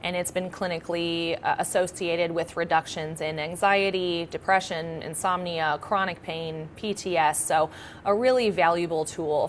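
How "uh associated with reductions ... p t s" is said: ordinary talk, nothing marked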